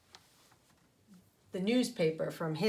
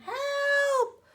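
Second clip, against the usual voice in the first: very high yelled help; mock scream for help; 4 dB louder than usual. Spider fleeing the vacuum drill, mock scream. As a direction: cry out in mock panic.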